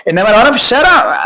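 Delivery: street tough voice. Pretend. Mock street voice.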